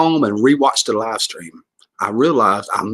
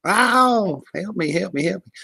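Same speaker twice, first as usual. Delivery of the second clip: tiny high-pitched cry for help; screaming donut; 9 semitones higher, slower than usual. Acted. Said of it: "I hope the donut doesn't scream".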